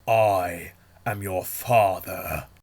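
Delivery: hammed-up movie villain line; Darth Vader impression. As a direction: drop the big reveal heavily and slowly. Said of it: "He imitated Darth Vader's deep breathing".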